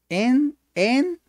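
"enpitsu" in high, loud, separated syllables. Shown as a demonstration. Teacher breaks word into syllables.

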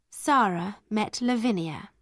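ordinary delivery, nothing marked

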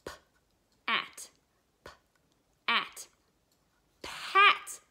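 usual speaking voice

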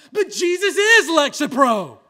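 loud, punched-out proclamation; emphatic declaration. Real, not acted. Preacher to congregation, sudden loud emphatic declaration. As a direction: land the line like a hammer blow. Punchline after quiet aside.

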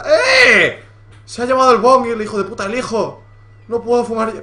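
loud, high outraged yell; furious cry at the thief who took the bong. Real, not acted. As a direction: shout in disbelief that the bong is gone. Son stole his bong.

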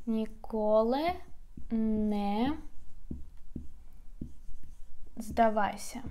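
Ніколи не здавайся word by word, long pauses; slow demo. Shown as a demonstration. Teacher dictating the phrase slowly for learners. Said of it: "how to say never give up".

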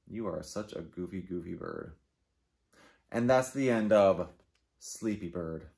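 ordinary delivery, nothing marked

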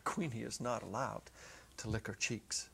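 ordinary talk

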